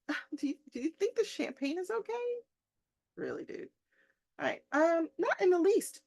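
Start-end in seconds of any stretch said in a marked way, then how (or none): 0.1-2.1 high, fast put-on voice of an eager host; mock fussy host. Teacher voicing the general as an eager host, high and fast. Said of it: "so he's like". 4.4-6.0 high, loud declaration; declaring. Acted. Rainsford answering the general's question, high and loud. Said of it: "declares Rainsford".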